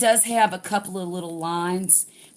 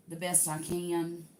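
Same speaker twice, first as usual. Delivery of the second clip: ordinary talk, nothing marked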